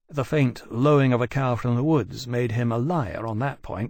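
ordinary delivery, nothing marked

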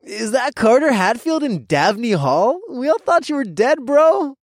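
high, loud nasal bro voice; loud obnoxious frat guy. A narrator's reading. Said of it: "a nasally voice called out".

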